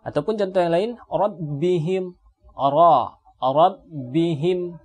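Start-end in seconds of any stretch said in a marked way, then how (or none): 1.1-4.8 rabbihim recited slowly with a full, heavy ra; thick ra. Shown as a demonstration. Teacher drilling rabbihim for students: slow, thick ra. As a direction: isolate ra, then rabbihim with the ra thick. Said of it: "ini dibaca dengan tebal".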